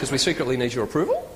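steep questioning rise on "approval"; statement turned question on "approval"; uptalk. Shown as a demonstration. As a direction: lift "approval" as if seeking agreement. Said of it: "we all go up at the end".

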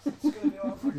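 laughing. Real, not acted. Laughing remark at the table.

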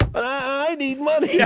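high, whiny grown son; hammed-up pleading wail. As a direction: beg dad in a high whine. Plays the whining son begging.

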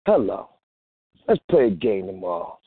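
normal talking voice

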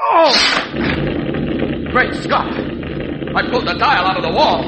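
high, loud amazed cry; astonished. Acted. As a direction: shout in disbelief. Shocked after pulling dial.

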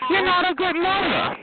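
high, scornful jab; high-pitched taunt. Real, not acted. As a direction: throw the accusation out in a high voice. Taunting someone about their parenting.

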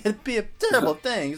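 a hint of a mobster's veiled threat; lightly voiced sly menace; smiling threat. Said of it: "the court saying".

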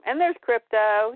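usual speaking voice

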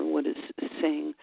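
usual speaking voice

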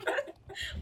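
squeaky wheezing laugh; helpless giggle; high breathless laugh. Real, not acted. Laughing fit mid quote.

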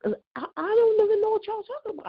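stunned denial of something she never did; baffled disbelief. Retold or imitated. Answering the accusation on the call, pitched high in disbelief. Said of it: "I said".

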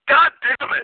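high-pitched exasperated movie yell; Michael Madsen. Retold or imitated. Retelling a film gag to the hosts, hammed-up yell. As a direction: burst out in a mock frustrated holler. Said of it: "and he just goes".

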